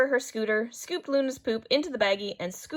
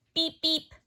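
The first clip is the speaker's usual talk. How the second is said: higher beep beep joining in; child beeping; 7 semitones higher than usual. Acted. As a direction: chime in like a little horn. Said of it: "Nate joined in".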